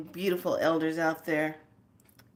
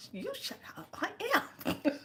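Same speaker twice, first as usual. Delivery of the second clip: much higher, laughing comeback; high teasing quote; 12 semitones higher, 8 dB quieter than usual. Retold or imitated. Elder teasing back, high and laughing. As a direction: squeak it back, half laughing. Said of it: "She goes".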